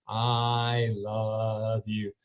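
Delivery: very slow, soft and tender; slow hushed heartfelt read. Retold or imitated. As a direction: savour the son's note, moved. Reading son's note, moved.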